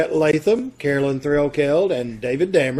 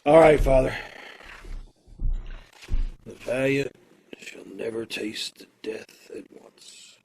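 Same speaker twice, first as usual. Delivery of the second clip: a condemned man, quiet and resigned; condemned prisoner; 3 semitones lower, 9 dB quieter than usual. Acted. Condemned man saying goodbye to the girl, resigned. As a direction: play the prisoner facing death, low and hushed. Condemned man's last scene.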